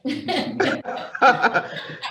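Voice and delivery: airy high-pitched giggle; high breathy laugh. Real, not acted. Host laughs at donor's joke.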